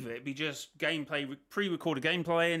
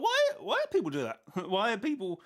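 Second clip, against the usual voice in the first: much higher and louder, exasperated; high-pitched imitation; 5 semitones higher, 4 dB louder than usual. Quoting Clark's complaint about creators, high exasperated voice. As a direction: squeak up in disbelief. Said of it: "that was how high I went there".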